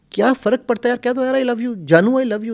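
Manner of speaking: high coaxing plea to say I love you; pleading. Shown as a demonstration. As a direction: urge him playfully, pitch up. Urging husbands to say love. (اونچی آواز میں پیار بھری منت)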